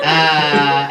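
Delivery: usual speaking voice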